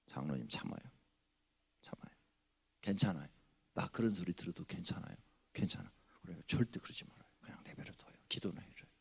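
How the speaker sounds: soft, hushed reassurance; gentle calming. Retold or imitated. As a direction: quietly soothe an angry elder. Pastor calming angry elder.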